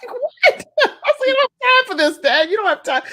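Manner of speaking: high, incredulous protest through laughter; laughing protest. Retold or imitated. Daughter telling dying dad there's no time for this, laughing protest. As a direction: squeal the objection while cracking up. Laughing, retelling her shocked reply.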